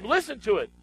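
ordinary delivery

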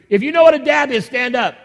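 rally the crowd to their feet; calling out. Real, not acted. Pastor calling the congregation to stand, loud and high.

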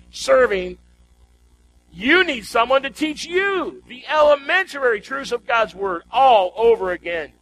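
ordinary delivery

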